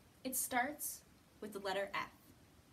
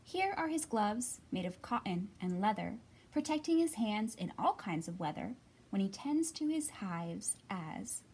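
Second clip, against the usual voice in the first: louder, rhythmic storybook read-aloud; reading to children; 6 dB louder than usual. Acted. Teacher reading a picture book to children, lilting read-aloud. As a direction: read the rhyming book to little ones.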